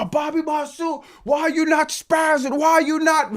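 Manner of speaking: high mocking voice of chat viewers, up-pitched; whiny demands from the chat. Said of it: "And I know some people are".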